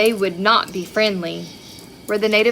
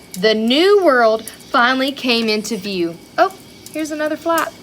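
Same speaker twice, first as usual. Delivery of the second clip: ordinary delivery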